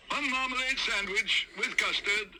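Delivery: high, slow called-out order; calling the order. Acted. Waiter calls the order back to the kitchen. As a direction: call it out to the kitchen.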